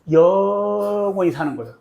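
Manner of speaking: 영원히 with a very long held first syllable; slow, sustained 영원히, then release; drawn-out 영원히. Shown as a demonstration. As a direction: stretch the start of 영원히 to sound endless. Stretches the word for forever.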